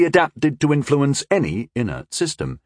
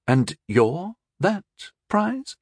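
slow, doubtful high question; skeptical; 8 semitones higher, slower than usual. A narrator's reading. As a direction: ask with open doubt. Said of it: "Rondel asked skeptically".

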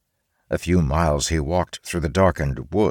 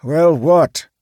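louder, high sharp question; annoyed; 11 semitones higher, 8 dB louder than usual. Acted. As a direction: snap back at the interruption. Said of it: "angry at the interruption".

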